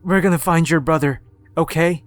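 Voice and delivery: tearful father. Acted. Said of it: "cried as he said".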